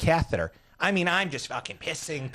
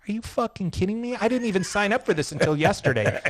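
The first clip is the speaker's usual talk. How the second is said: higher, disbelieving, laughing by the end; raised "are you fucking kidding me" in mock outrage; incredulous retort; 8 semitones higher than usual. Retold reply on the cliff.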